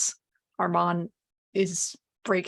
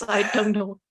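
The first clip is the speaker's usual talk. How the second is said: normal talking voice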